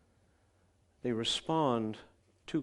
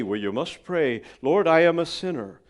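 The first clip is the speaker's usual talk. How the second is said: preachy demand; 4 semitones higher, 9 dB louder than usual. Retold or imitated. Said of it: "We say".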